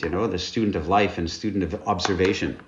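ordinary talk

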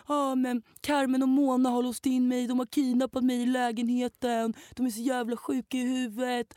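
mock him whining like a child to his father; whiny boy calling dad. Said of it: "han ringer sin pappa och bara".

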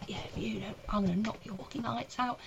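hushed, under-the-breath threat; retold menacing threat. Angry punter threatening the comic, hushed menace. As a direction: imitate a quiet threat, well below normal volume. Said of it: "He was doing all this business".

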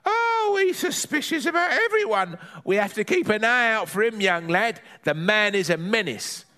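put-on high, chatty old granny gossiping; a cheeky granny grumbling about the nosy neighbour; Granny's voice.

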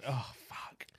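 breathy whispered curse. Real, not acted. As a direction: whisper it in shock. Stunned reaction, whispered.

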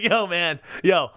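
ordinary talk, nothing marked

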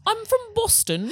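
high, hammed-up fake Boston accent; deliberately bad Boston accent. Shown as a demonstration. Friends joking after failing the accent, awful Boston accent. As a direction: do the worst Boston accent possible. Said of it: "doing it as badly as possible".

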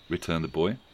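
ordinary delivery, nothing marked

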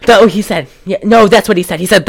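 normal talking voice

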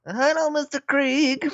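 mock sweet greeting. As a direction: greet him in a silly falsetto. Acted reply to neighbour.

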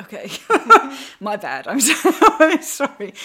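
giggling sheepish apology, high and loud; embarrassed laughing sorry. Retold or imitated. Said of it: "And I was like".

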